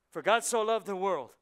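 loud, high declaration; preaching punch. Acted. Pastor setting up a joke about God's love, loud proclaiming. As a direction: proclaim it to the room.

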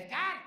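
high, loud "Edgar" called out as "Eth-gard"; "Edgar" hollered high with a TH; mom calling Edgar. Acted. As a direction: call "Edgar" like his mom, TH for the D. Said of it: "what does your mom call".

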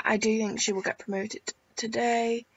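ordinary talk

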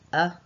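a clipped short a sound; short a phoneme. Shown as a demonstration. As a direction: give just the a sound. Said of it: "the next one".

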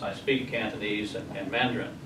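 ordinary delivery

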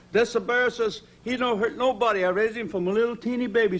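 imitating the Italian bear owner, lightly; Italian accent. [name] imitating the Italian bear trainer, light Italian accent. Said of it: "he was an Italian guy".

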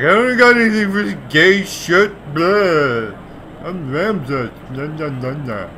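high, silly put-on voice, loud then fading; mock him with a high, goofy blah-blah voice; mocking character impression. Gamer mocking how Ramses comes off, silly impression. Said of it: "The way he comes off".

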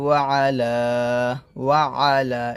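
ওয়া আলা with a throaty ع opening আলা; clear ain. Shown as a demonstration. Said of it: "আইন উচ্চারণটা এখানে নিয়ে আসবেন".

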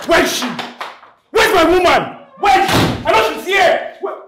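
loud, high furious yelling; jealous shouting. Acted. Jealous man storming in hunting for his woman, shouting loud and high. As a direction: storm in and bellow for her.

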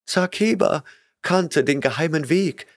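put-on panting frightened girl; gasping girl. A narrator's reading. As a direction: gasp it out. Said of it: "keuchte sie".

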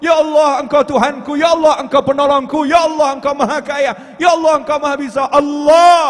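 loud, high, building to a shout; fiery invocation. Acted. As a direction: preach it out with rising force. Rousing invocation at full force.

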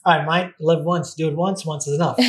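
a light Aussie twang, mate and all; lightly voiced Australian bloke; Australian accent. Shown as a demonstration. To the host, doing the line in an Australian accent. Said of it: "the Australian way to do it".

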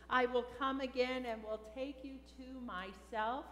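normal talking voice

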